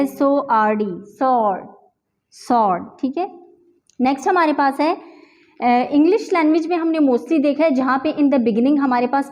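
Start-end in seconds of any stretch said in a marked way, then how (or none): 1.2-2.9 sword as "sord", no w; silent w in sword. Shown as a demonstration. Teacher repeating sword with no w. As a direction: drop the w in sword. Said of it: "डब्ल्यू का sound बिल्कुल भी नहीं है".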